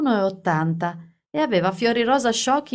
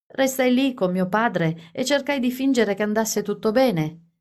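ordinary delivery, nothing marked

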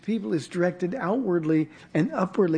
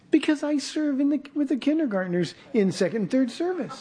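put-on higher, childlike voice with a laugh; little boy voice; 7 semitones higher than usual. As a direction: voice the earnest kid, a bit high and amused. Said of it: "He says".

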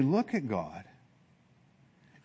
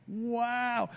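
higher wow; 9 semitones higher, 5 dB quieter than usual. Retold or imitated. Awed at canyon again.

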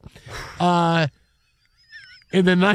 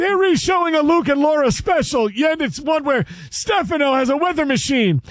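high-pitched put-on parent voice; high mock scolding over a TV show; parent glued to soaps; 8 semitones higher than usual. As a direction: play an indignant soap fan, pitched way up. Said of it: "I get yelled at if I call my parents".

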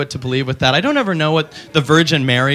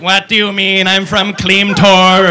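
slow robotic alien; 4 semitones higher, 4 dB louder, slower than usual. Retold or imitated. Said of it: "the alien will be like".

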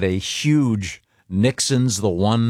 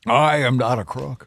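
slow, higher presidential impression; Richard Nixon; 4 semitones higher, slower than usual. Host quoting the sweaty president, slow mock impression. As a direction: declare it slowly in a politician's put-on voice. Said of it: "Tricky Dick".

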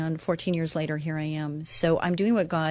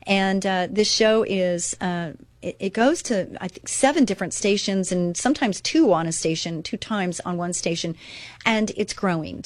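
ordinary delivery

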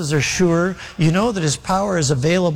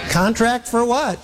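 surprised; 7 semitones higher than usual. Retold or imitated. Daughter shocked by contract.

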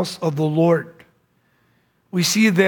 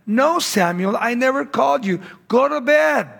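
raised pitch, exasperated dismissal; mildly acted annoyance, pitch climbing; exasperated priest; 6 semitones higher than usual. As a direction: send the child back to bed, fed up. Woken priest sends boy back.

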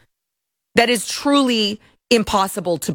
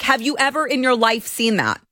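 ordinary talk, nothing marked

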